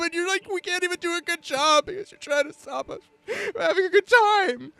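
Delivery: high, wailing sobbing plea; high-pitched crying rant; crying npc. Acted. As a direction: blubber it out in a high voice. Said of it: "and it's just like".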